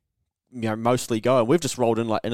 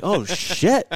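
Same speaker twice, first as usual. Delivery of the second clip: high, alarmed oh shit; sudden worried realisation; mock alarm; 7 semitones higher, 4 dB louder than usual. Realising danger in bar.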